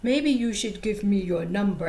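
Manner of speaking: badly faked African accent; amateur African dude accent; terrible African accent. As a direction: imitate an African man making a pass. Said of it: "this is the terrible African accent".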